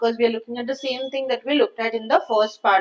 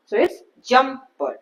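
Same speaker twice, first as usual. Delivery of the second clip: jumper, JUMP slow and hit harder than per; stress on jump; slower than usual. Shown as a demonstration. Teacher showing the class where jumper's stress falls, slow and firm. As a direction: slowly lean on the JUMP in jumper. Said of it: "जंप वाले part पे ज्यादा stress है".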